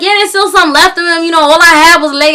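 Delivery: lightly mimicked country caller; thick country accent. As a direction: put on a casual country twang. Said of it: "talking in this thick country accent".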